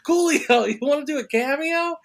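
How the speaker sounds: high-pitched, eager invitation; re-enacted starstruck asking; eager hail. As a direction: call out to a celebrity with a hopeful pitch. Eagerly hails star in casino.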